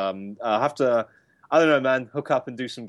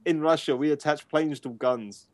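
put-on Russian accent; 6 semitones higher than usual. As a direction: boast like a Soviet patriot. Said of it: "in Ru-, in".

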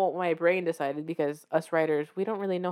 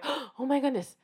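higher-pitched gasp of realization; gasp, then let it out higher; gasping relief; 6 semitones higher than usual. Acted. Writer back from a break, gasping with relief. Gasped relief on returning to writing.

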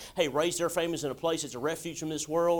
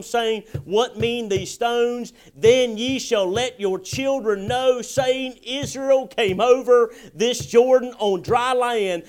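slow, weighty King James reading; preacher reading the verse aloud to the church; scripture reading; 3 semitones higher, 6 dB louder, slower than usual. Acted. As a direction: read each word of the verse slowly and solemnly. Reading the Bible text aloud.

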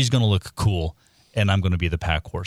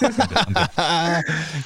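much higher, squeaky refusal; high silly voice; 8 semitones higher, much slower than usual. Acted. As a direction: pitch it way up in a goofy voice. Repeating refusal in new voices.

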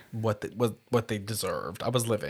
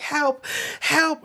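mock cry for help; 14 semitones higher than usual. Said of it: "she's, like, crying and screaming".

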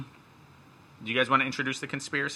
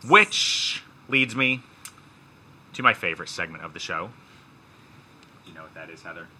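dramatic segment intro; 3 semitones higher than usual. Pretend. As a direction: build suspense before each word. Hammed-up intro of favorite segment.

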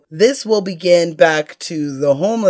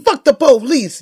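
high yelled movie line; shouted quote; 8 semitones higher than usual. Retold or imitated. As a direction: yell it like the character.

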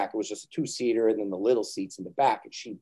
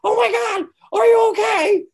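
much higher, louder frantic shout; panicked wife; 9 dB louder than usual. Retold or imitated. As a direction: mimic an overreacting mother. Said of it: "my wife was like".

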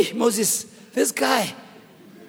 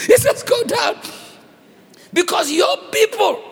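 high, laughing mock command; laughing retelling; 10 semitones higher than usual. Preacher retells God disowning the people, amused. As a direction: squeeze the command out through amused disbelief.

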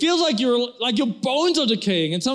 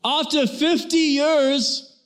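repeated slowly for deaf wife; 6 semitones higher, much slower than usual. Retold or imitated. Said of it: "He goes".

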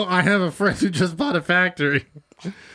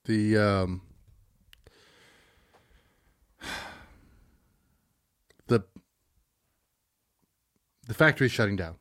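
breaking bad news; 7 semitones lower, 5 dB quieter than usual. Improv scene, boss breaks news.

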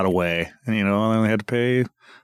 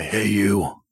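gravelly voice. Real, not acted. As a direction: growl it like a movie bad guy. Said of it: "now I sound cool, like I could be in some sort of Marvel movie as a bad guy".

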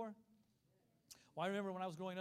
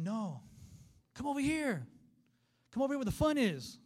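high, loud beckoning call; 6 semitones higher, 8 dB louder than usual. Retold or imitated. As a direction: holler invitingly across a pool. God calls swimmer to deep end.

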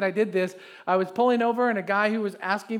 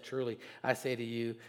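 low, soft, tender promise; quiet tender promise; gentle Jesus; 7 semitones lower, 9 dB quieter than usual. Acted. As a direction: speak softly as Jesus comforting. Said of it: "And Jesus said".

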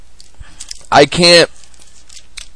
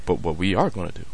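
very soft, low mob boss; hushed mobster proposing a deal; 9 semitones lower, 14 dB quieter, faster than usual. Acted. Father making deals as mob boss, soft and low.

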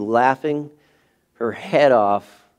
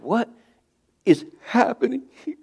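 high, bewildered cry of disbelief; baffled exasperation; 11 semitones higher than usual. Retold or imitated. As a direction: throw the question up high, at a loss. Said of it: "And we're like".

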